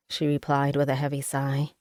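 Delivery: usual speaking voice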